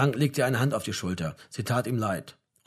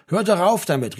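higher, louder exasperated call; upset cry; 7 semitones higher, 7 dB louder than usual. A narrator's reading. Mother breaking into the family quarrel, calling out upset. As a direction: call out in distress, no real sobbing. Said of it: "rief seine Mutter und begann zu weinen".

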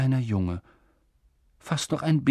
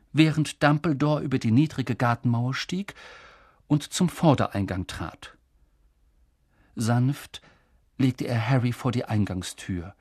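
usual speaking voice

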